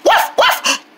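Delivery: high, short puppy barks; two quick high woofs; dog barking. Acted. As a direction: yap like a little dog. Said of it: "that did sound like a dog".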